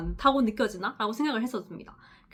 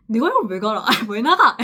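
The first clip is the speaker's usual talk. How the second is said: loud bewildered question, laughing; baffled outburst; 9 dB louder than usual. Retold or imitated. New nurse baffled that the guardian walks out, loud bewildered question. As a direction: blurt it out, puzzled and loud. Retelling early confused self.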